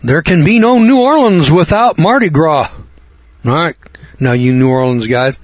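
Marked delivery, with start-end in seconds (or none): 0.0-2.7 a touch higher, firm woman's insistence; lightly voiced defiant woman; mild mock insistence. Preacher quoting the New Orleans woman, mildly insistent. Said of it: "and this woman said".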